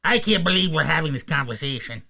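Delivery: exasperated cartoon mentor; put-on, fed-up teacher character; King Kai. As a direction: grumble it in an odd cartoon voice. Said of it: "I've got it pretty nailed down with King Kai".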